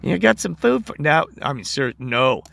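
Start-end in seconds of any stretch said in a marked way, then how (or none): 0.0-0.9 put-on high, cooing baby talk to a pet; sweet dog-talk voice. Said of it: "people are talking constantly to their dog".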